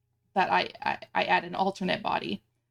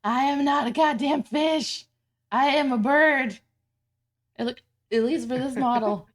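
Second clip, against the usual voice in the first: mock outrage; 5 semitones higher, 4 dB louder than usual. Mock protest about hiccups.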